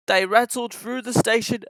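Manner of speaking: ordinary delivery, nothing marked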